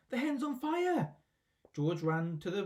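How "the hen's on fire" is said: put-on high, slow, alarmed yell in an old woman's voice. A narrator's reading. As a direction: scream the alarm from the roof. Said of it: "Grandma yelled".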